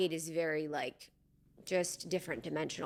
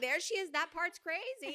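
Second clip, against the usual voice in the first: much higher, giggling, trailing off softer; giggly mock pointing-out; 10 semitones higher, 5 dB quieter than usual. Friend imitating herself greeting a wild inner part, high giggle. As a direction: giggle as you point at your own crazy side. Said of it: "Yeah, I was like".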